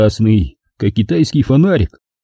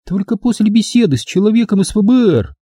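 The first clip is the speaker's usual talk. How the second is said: much higher character voice, firm refusal; Angela cutting him off; 11 semitones higher than usual. A narrator's reading. Angela refusing his advances, high and curt. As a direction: refuse flatly, pitched high. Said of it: "отрезала Анжела".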